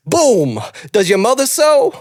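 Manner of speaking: punched opener, then high questioning lilt; celebrity impression rising high on the question; Christopher Walken. Retelling the janitor scene, rising Walken impression. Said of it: "and then he goes".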